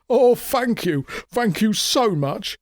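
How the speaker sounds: grateful swindler. A narrator's reading.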